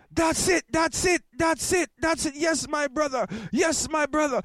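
high shouting preacher egging on the congregation; excited preacher. Retold or imitated. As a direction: cheer him on like a fired-up pastor. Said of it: "And hear him now".

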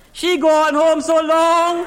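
high, loud, long drawn-out cry; exasperated exclamation. Acted. Exasperated driver on woman's departure.

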